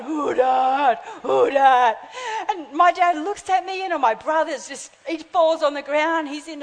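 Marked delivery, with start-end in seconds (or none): 0.0-2.0 slow, drawn-out mock spooky call; imitated spooky voice. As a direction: stretch the words like a croaking phantom. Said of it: "and he's going".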